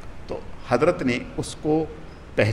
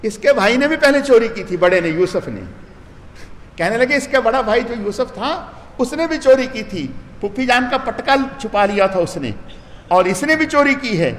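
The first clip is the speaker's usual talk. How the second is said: accusing; 6 semitones higher, 3 dB louder than usual. Acted. Brothers accusing Yusuf of theft.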